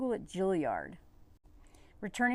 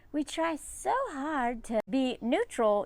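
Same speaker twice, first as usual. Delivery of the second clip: slow, higher imitation of the wife; mock trad wife; 6 semitones higher, slower than usual. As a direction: drawl it out sweetly, pitched up.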